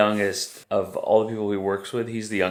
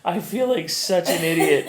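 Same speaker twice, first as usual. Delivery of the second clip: higher pitch, laughing as she quotes him; laughing through quote; 9 semitones higher than usual. Retold or imitated. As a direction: giggle through the words. Retelling son's embarrassed phone story.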